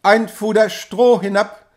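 loud call upward. A narrator's reading. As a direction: shout it up to the men above. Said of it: "rief er denen droben zu". (lauter Zuruf nach oben)

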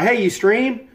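hammed-up impression of a new viewer; lightly voiced eager viewer; mock version of Bronx. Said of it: "he doesn't say it like that".

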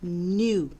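gnu said as noo, no g sound at all; silent g gnu. Shown as a demonstration. Librarian teaching children to say gnu, silent g. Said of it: "but it's pronounced".

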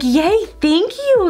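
high, slow, drawn-out happy thanks; delighted thanks. Retold or imitated. Thanking her boyfriend for the palette, high and delighted. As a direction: squeal out a grateful yay.

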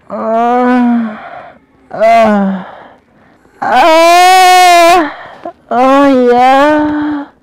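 loud high groans of pleasure; massage chair moans. Real, not acted. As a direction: moan loudly as the massage hits.

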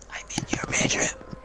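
spooky whisper, lurking close; creepy whisper. Pretend. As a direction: whisper it like a stalker under the bed. Creepy joke, hiding in his room.

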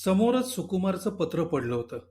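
ordinary delivery, nothing marked

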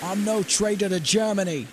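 high-pitched calling protest; indignant protest. A narrator's reading. Hartness yells back, accused of treason.

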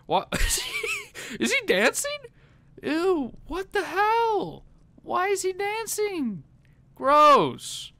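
high, slow laughing disgust; laughing disbelief. Real, not acted. Laughing disgust at dancing man.

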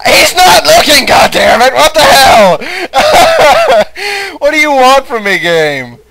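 furious shout. Real, not acted. Gamer caught by a guard, yelling angrily at the game. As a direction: scream at the game in disbelief.

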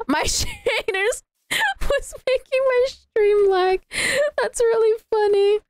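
high, giggly, breaking up; talking through laughter. Real, not acted. Streamer finding shaders caused the lag, helpless giggling. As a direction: barely get it out for laughing.

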